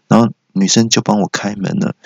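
ordinary talk

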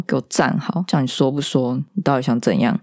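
ordinary talk, nothing marked

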